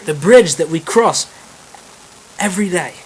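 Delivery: put-on Irish accent slipping as it swells; shaky Irish accent. William points out the bridge to Edward, shaky Irish accent. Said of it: "a little shaky on the accent".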